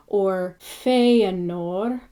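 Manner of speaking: Feanor said FAY-a-nor, slow; slow Feanor with an A sound on e. Shown as a demonstration. Teacher modelling Feanor slowly with an A sound. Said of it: "it has an A sound".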